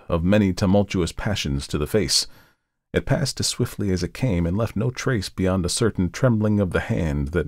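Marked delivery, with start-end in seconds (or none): none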